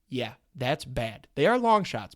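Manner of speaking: normal talking voice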